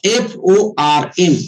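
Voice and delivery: एफ ओ आर एम spelled slowly, each letter apart. Shown as a demonstration. Trainer spelling the FORM formula out for the team, slowly letter by letter. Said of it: "form".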